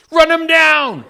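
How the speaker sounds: full-out high, loud acted shout; panicked yell. Panicking passenger urging the driver on, acted panicked yell. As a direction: yell it in a panic at the top of your range. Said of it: "Paul next to me is panicking".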